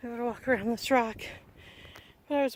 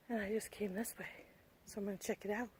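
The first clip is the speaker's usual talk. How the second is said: whispering; 3 semitones lower, 12 dB quieter than usual. Real, not acted. Quiet aside on trail.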